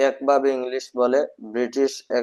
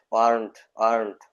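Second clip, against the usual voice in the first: weren't said very slowly and clearly; slow model pronunciation; 3 semitones lower, much slower than usual. Shown as a demonstration. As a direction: stretch out weren't for learners. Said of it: "weren't হবে". (weren't ধীরে ধীরে উচ্চারণ)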